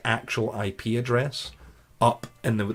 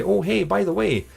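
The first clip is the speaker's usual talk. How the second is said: higher-pitched, offhand phone opener; casual caller; 10 semitones higher than usual. Acted. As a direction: lightly act a casual caller fishing for information. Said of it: "someone to call your place of work and say".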